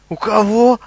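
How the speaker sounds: loud, high astonished question; incredulous. A narrator's reading. Goblin hearing he should ask truth, astonished. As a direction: blurt it out in disbelief. Goblin's eyes bulge at the answer.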